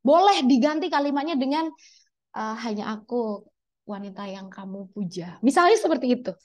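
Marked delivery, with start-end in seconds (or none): 2.7-5.4 soft, sinking murmur; a low murmur as if whispering to a crush; hushed demo. Shown as a demonstration. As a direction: say it under your breath to her. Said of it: "harus berbisik ya".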